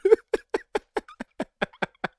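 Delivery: high, loud cackle of embarrassment; high embarrassed laugh. Real, not acted. Streamer cracking up at his own shameful slides, high laughter. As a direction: laugh helplessly at yourself.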